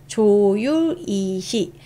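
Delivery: 조율이시 spelled out very slowly; slow recitation. Shown as a demonstration. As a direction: spell it out for memorizing. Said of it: "drilling the mnemonic slowly". (아주 느리게 또박또박)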